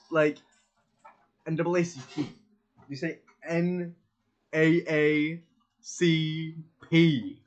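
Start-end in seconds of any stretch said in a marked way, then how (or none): 1.5-2.3 N double A C P rattled off as one name; quick, run-together N double A C P; fast, with rhythm. Shown as a demonstration. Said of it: "fast and with, like, a rhythm". 3.5-7.4 N A A C P loudly spelled out with gaps; mock-deliberate N, A, A, C, P; individual letters. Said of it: "he says it two individual letters".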